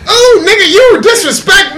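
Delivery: put-on high, loud indignant girl voice; hammed-up offended date; acting out the offended date's outrage; mock offended girl. Acted quarrel, girl's voice.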